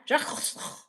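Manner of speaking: mock-French, all throat and no voice; hammed-up French gargle; exaggerated French accent. Shown as a demonstration. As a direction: rasp the name out like an overdone Frenchman. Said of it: "I thought I was being French".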